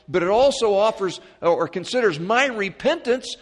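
high, loud preacher's cadence; full-force preaching. Acted. Preacher proclaiming redemption to the congregation, full-force preaching. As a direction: proclaim it to the whole church.

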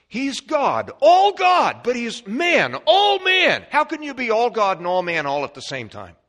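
high, loud preaching that climbs to a shout; full-force preaching. Acted. Preacher pounding out the mystery of Christ to the congregation, full-force high shout. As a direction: preach it out with everything you have.

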